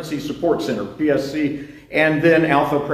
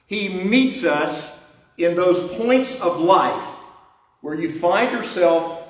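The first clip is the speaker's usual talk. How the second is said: preaching; 4 semitones higher than usual. Acted. Preaching, slow and lifted.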